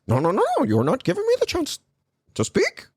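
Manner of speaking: very high, fast indignant protest; Jordan Peterson. Acted. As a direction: squeak out the complaint fast. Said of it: "Jordan Peterson kept trying to fucking steal my show".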